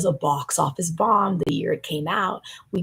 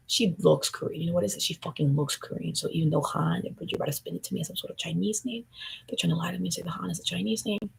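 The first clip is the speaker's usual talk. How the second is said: normal talking voice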